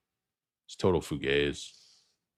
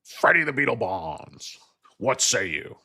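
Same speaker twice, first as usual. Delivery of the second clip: McLaughlin Group host; 8 semitones higher, 5 dB louder, slower than usual. Retold or imitated. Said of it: "the McLaughlin Group".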